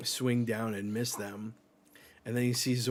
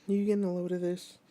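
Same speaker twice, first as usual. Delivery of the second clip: higher, fast put-on voice; character voice; 8 semitones higher, 3 dB louder, much faster than usual. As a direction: rattle it off in a squeaky character voice. Redoing the line in voice.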